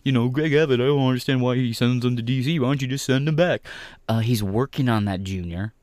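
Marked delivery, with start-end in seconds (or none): 0.0-3.6 higher, louder whiny mock voice; mocking whiny Tim Pool. Streamer mocks Tim Pool's confusion in a whiny mock voice. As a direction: sneer it like a confused whiner. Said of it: "Sitting here acting like".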